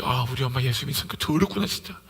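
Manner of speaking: put-on low aside of a disappointed child; acted disgust. Child about churchgoing mother, low acted disgust. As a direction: voice a child's private grumble, pitched low.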